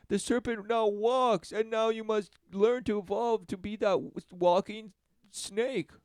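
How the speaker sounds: mock it with fake wonder; sarcastic mock awe. Sarcastic mocking narration.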